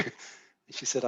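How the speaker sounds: ordinary talk, nothing marked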